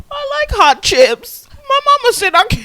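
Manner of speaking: put-on high, loud Southern kid voice; hammed-up Southern accent, shrieking upward. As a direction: drawl it out like a Southern child. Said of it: "why did we get a southern accent".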